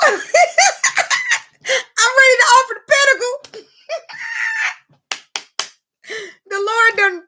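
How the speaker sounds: very high laughing-crying cry; overjoyed. Real, not acted. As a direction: squeal it out through laughter.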